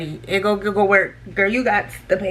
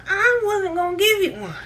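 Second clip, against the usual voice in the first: sassy inner retort; 7 semitones higher than usual. Retold or imitated. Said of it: "I said it in my head".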